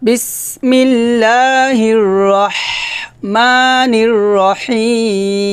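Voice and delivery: slow chanted recitation; Quran recitation. Shown as a demonstration. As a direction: draw each word out in a recitation tune. Recites the model verse.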